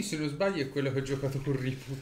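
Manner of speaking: normal talking voice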